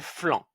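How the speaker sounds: flan with a nasal an; word flan. Shown as a demonstration. Teacher giving flan as an example. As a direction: say flan clearly. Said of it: "F L avec A N, ça fait".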